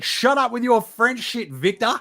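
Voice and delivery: furious coach barking at his player; angry coach rant. Retold or imitated. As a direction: build from gripe to explosion. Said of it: "Ime be like".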